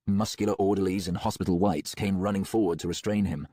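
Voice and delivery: usual speaking voice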